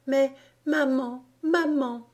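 choked disbelief. A narrator's reading. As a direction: voice a girl gasping at the news, pitch lifted. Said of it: "suffoquée, répétait continuellement".

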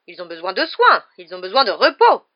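loud, insistent objection; spluttering protest. A narrator's reading. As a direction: stress the patients' needs. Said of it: "balbutia madame Pomme Fraîche". (protestation balbutiée)

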